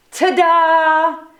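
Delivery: ta-da. Acted. Moose presenting Turkey to his wife, showy. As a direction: present it with flair.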